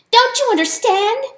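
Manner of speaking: high, loud frantic mouse cry; squeaky mouse cry to the animals. A narrator's reading. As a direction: shout it out, desperate to be heard. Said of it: "the mouse cried".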